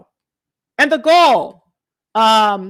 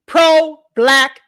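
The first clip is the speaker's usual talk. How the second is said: loud, emphatic restatement; emphatic repeat; 2 semitones higher, 6 dB louder than usual. Real, not acted. Commentator repeats phrase for emphasis.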